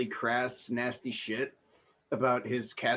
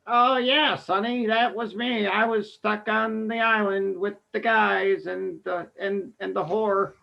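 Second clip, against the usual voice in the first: put-on much higher, slow old lady voice; 7 semitones higher, 3 dB louder, slower than usual. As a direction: play a dotty old actress reminiscing. Old actress voiced in scene.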